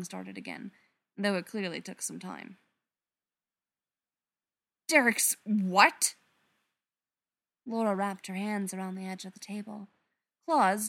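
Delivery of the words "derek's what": shocked outburst. Acted. Brother's shocking news revealed.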